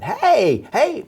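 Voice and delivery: high, loud "hey" shouts; retold yelling. Trapped survivor warning the jackhammer crew, yelling. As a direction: re-enact yelling up at the drillers. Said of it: "Well, now I'm yelling".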